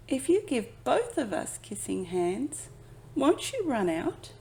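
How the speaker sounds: high, small anxious cub; worried child voice. Acted. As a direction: ask it with a child's worry. Chester worried question.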